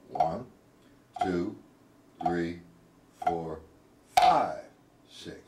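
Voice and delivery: a slow, low count with an accent on five; slow, even count with a punch on five. Shown as a demonstration. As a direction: count it out slowly, leaning on five. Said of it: "Let me do that slow".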